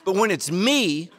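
ordinary talk

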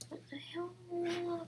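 whispered. Real, not acted. Frustrated aside during tech trouble.

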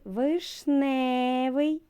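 «вишневий» said very slowly, with НЕ loud; stress on the second syllable. Shown as a demonstration. Teacher to children, drawing out the word to show the stressed syllable. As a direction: stretch out «вишневий», leaning on НЕ. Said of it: "наголос паде на другий склад".

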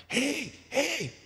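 preacher voices wisdom crying out at the gate in a high voice. Acted. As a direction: call out high like a woman at the gate. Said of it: "she's crying out".

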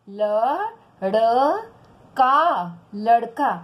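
very slow, separated sounds, then the whole word; word pronunciation drill. Shown as a demonstration. Teacher spelling out words for children, slow pronunciation demo. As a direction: sound out each syllable with a pause, then blend. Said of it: "कुछ शब्द ही ma'am कराती हैं".

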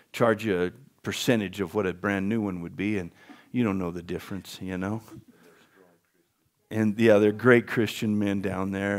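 soft aside. Real, not acted. Aside plugging tire shop.

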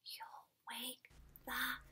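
whispered warning, fading out; hushing whisper. A narrator's reading. Townsfolk warning Carolina about the giant, whispered. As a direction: hush them, barely voiced. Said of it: "said everyone".

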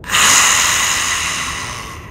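slow, unvoiced creature exhale; breathy dragon sigh; long rasping breath. A narrator's reading. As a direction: let out an eerie drawn-out hiss. Lava dragon's wordless breathy response.

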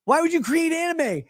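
high pleading yell. Retold or imitated. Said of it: "I'm like".

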